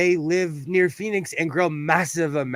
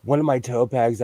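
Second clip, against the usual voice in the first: normal talking voice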